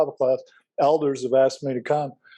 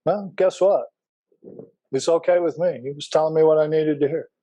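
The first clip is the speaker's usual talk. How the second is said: ordinary talk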